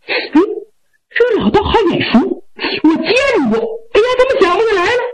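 high, youthful voice, wondering aloud; puzzled young man. Acted. Youth puzzling over a familiar old Taoist, high and bewildered. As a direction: pitch it high, searching the memory.

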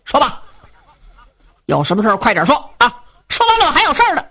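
fast, curt and irritated, pitch rising to the end; impatient young man. Acted. Son to his father, wanting it over with, impatient. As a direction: brush the old man off, hurry him along. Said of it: "还显得挺不耐烦".

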